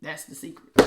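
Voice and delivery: soft, breathy whisper; whispered secret. Real, not acted. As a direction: whisper it like a confidant. Hinting at the secret.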